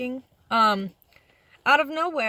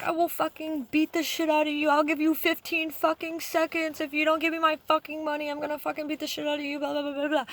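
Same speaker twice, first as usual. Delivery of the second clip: raised, fast retold threat; ranting like the furious dishwasher; a hint of an enraged coworker's threat; mock threatening rant; 5 semitones higher than usual. Retold knife-wielding coworker threatening.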